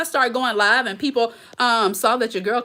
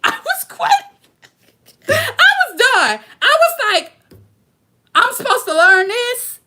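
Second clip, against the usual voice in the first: mock exasperated squeal; 6 dB louder than usual. Retelling her editing meltdown to viewers in a high mock squeal.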